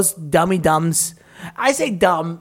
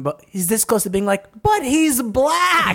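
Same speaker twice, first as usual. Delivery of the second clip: mock pollster voice; 6 semitones higher, slower than usual. Mocking pollsters' hesitation.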